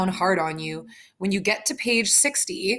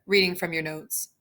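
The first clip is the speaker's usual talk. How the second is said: ordinary delivery, nothing marked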